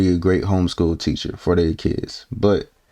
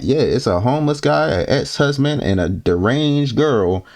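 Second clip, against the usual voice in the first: normal talking voice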